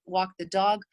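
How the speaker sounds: ordinary talk, nothing marked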